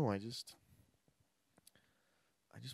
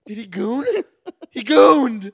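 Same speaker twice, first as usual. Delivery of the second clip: high, loud excited yell; excited shout; 9 semitones higher, 13 dB louder, slower than usual. Acted. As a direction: cry it out in shock. Acted movie scene outburst.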